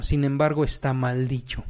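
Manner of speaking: normal talking voice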